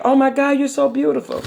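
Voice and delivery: light mock gush over the bride; sister in awe. Sister seeing the bride made up, lightly imitated awe. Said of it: "she was like".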